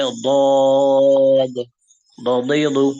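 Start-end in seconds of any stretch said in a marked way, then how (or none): 0.0-1.7 দদ thick with tongue curled; heavy dad. Shown as a demonstration. Teacher demonstrating the heavy letter. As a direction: say দদ from the side of the tongue. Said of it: "বলার চেষ্টা করতে হবে".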